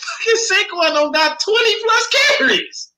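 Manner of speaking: high, loud, incredulous demand; exasperated outburst. Real, not acted. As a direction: climb into a high-pitched protest. Exasperated rant over the play calling.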